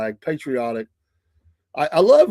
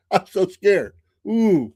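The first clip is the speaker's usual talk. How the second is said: mock fear; 6 semitones higher, 3 dB louder, slower than usual. Mocking Denmark's threats.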